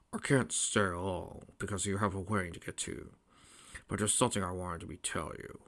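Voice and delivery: subdued royal father; gentle old king. A narrator's reading. King Acorn visits before the wedding, gentle.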